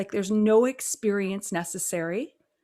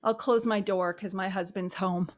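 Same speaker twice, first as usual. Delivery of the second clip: ordinary delivery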